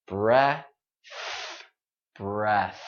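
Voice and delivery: "breath" ending in a voiceless TH, only air. Shown as a demonstration. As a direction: let pure air out at the end of "breath". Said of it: "just air, only air".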